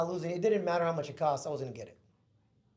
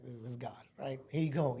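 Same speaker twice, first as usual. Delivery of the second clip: ordinary talk, nothing marked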